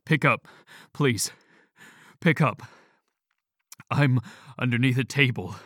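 panicked voice on a phone line; phone message. Acted. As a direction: call out desperately down a bad phone line. Phone message from father in tower.

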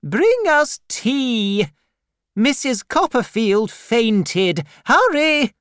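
loud, high, slow commanding call; urgent calling out. Acted. Aunt Betsy orders tea from the kitchen, loud and urgent. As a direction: hurry the servant along.

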